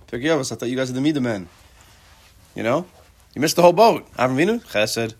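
loud, high mock indignation at Pirkei Avos; mock challenge. Teacher mock-scolding Pirkei Avos for missing chesed, loud and high. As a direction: playfully call them out, hello?.

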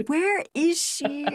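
high, drawn-out "where" question; mock exasperated demand. He finds her gone and demands answers, exasperated. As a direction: draw it out in high-pitched disbelief.

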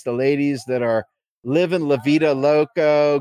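usual speaking voice

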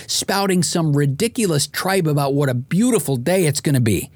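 usual speaking voice

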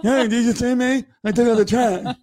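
high-pitched mock boy begging for notice; boastful boys. Boy wanting parent to notice chores, high mock boast. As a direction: imitate an eager boy, pitch way up. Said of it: "Like the boys go".

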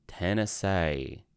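"Tennessee." drawn out slowly in a mock Southern accent, ending "see" as "say"; Southern accent try. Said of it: "is that a good Southern accent?".

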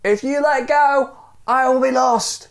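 high-pitched acted desperation; pleading call. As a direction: plead upward from the end of a rope. Dangling stranger pleads from below.

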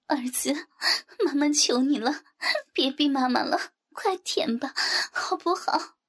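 high, whimpering plea; begging mother. Acted. The mother begging her son, high whimpering voice. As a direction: beg him, voice climbing.

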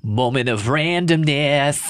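slow, laughing Boston accent; drawn-out Boston accent through a laugh. Shown as a demonstration. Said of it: "Do your Boston accent".

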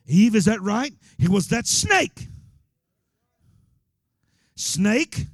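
loud, high, slow questioning; preaching at full force. Acted. Preacher voicing God interrogating Eve, loud. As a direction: drive each question home.

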